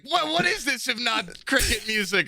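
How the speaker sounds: high, puzzled squeak of a question; mock baffled Brian. Friend protesting the cricket music in high disbelief. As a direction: play it up as outraged disbelief. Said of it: "Brian's like".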